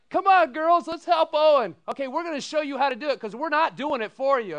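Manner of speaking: high, loud bright call; rally the girls; cheerful rallying. Retold or imitated. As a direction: dorm sisters calling to help. Retold gals rallying.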